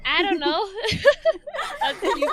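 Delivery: giggling through the words; laughing. Real, not acted. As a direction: laugh while you say it. Laughing at the shrug joke.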